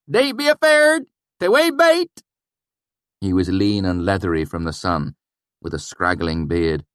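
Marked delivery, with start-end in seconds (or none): 0.1-2.2 loud, high reassuring shout. A narrator's reading. Swineherd shouting to strangers about pigs, loud and high. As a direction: call out to calm the strangers. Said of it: "he shouted".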